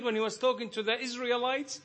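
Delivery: high, loud, ringing sermon voice; full-force preaching. Acted. Preacher urging the brethren, high and loud. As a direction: preach it out, climbing with fervor.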